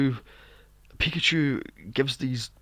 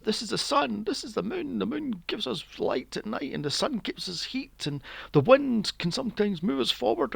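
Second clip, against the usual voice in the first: put-on high, sing-song child's lesson; put-on high-pitched childlike explaining; imitated little girl; 6 semitones higher than usual. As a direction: recite it like an earnest small girl. Mimics little girl teaching.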